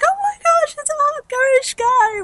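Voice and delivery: shrill, very high mock voice; mocking onlookers. Mocking onlookers teasing fans, very high squeaky voice. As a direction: squeak it out like a scoffing fan. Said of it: "They're like".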